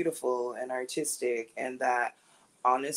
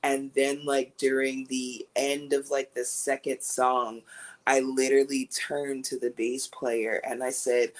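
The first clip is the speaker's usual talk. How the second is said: ordinary talk, nothing marked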